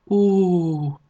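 long, high romantic sigh. A narrator's reading. Koffer Karolina is moved by the promised tale, sighed oh. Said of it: "sóhajtott fel Koffer Karolina".

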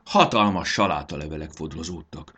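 plain reading voice

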